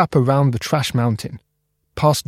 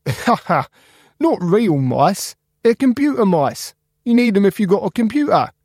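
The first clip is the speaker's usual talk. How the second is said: laughing Spark; 8 semitones higher than usual. Acted. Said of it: "Spark laughed".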